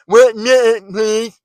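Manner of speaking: "minute" mangled high and loud; mock slurred "minute" as senseless noise; high, loud mush-mouthed "minute"; stupid-sounding imitation. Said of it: "You sound stupid when you talk like that".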